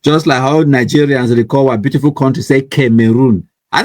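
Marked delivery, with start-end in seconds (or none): none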